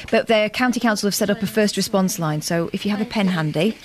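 normal talking voice

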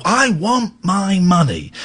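a touch of the striking labourer; mock working class demand. To the club boss, slow working class demand. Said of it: "I got proper working class labour".

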